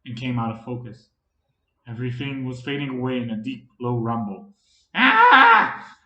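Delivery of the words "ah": sudden high, loud cry of alarm; startled shout. A narrator's reading. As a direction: jolt awake with a loud yelp. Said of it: "Mika shouted".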